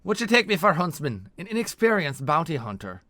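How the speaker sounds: loud, high, indignant comeback; scornful. Acted. Bounty hunter scoffing at her partner's doubt, scornful. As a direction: scoff as if insulted by the question. Veliana scoffs at Vold's question.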